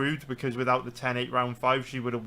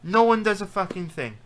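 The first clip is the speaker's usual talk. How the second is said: furious pundit venting about robbed fighters; exasperated outburst; 7 semitones higher than usual. Real, not acted. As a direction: vent it like nobody ever listens. Furious rant about judging.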